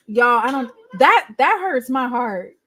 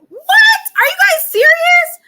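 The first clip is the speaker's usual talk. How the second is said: loud, high disbelieving shout; exasperated shout; 10 dB louder than usual. Real, not acted. Streamer shouting at chat over broken audio, exasperated shout. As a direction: yell it at the screen in disbelief. Chat still can't hear.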